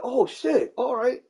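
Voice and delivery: high, loud "oh shit" of sudden recognition; mock surprise. To the neighbor, suddenly recognizing the name, high and loud. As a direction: act like it just clicked.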